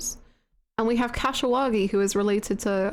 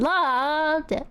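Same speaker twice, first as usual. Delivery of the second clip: loved it, lifted up high; playful echo, pitched up; higher playful repeat; 4 semitones higher than usual. Shown as a demonstration. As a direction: sing it out brighter.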